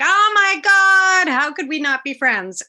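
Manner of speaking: retold gushing shriek, high and loud; excited scream. Two new friends finding each other, imitated excited scream. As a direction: cry it out high and drawn-out, then ease off. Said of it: "screaming at each other".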